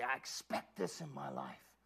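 soft whisper; whispered confession. Shown as a demonstration. Preacher modeling a whispered confession on the commute. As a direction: breathe it as a vow. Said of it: "And say".

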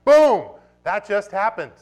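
preacher hyping his church over a sick burn; excited. Real, not acted. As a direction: pump it up like a crowd reacting. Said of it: "makes me get a little bit excited".

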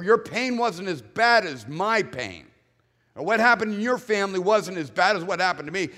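hammed-up bitter comparison; mocking one-upper. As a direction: sneer it out as a competing complaint. Mocking a one-upping complainer.

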